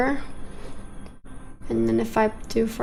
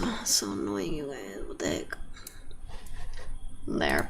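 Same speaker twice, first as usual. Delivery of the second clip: grumbling; 14 semitones lower, 12 dB quieter than usual. Pretend. Annoyed at the game view.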